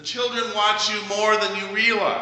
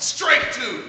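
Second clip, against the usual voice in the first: higher, a touch louder umpire call; yelled call; 7 semitones higher, 4 dB louder than usual. Retold or imitated. Pastor voicing the boy calling strike two, raised call. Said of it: "and he yelled out".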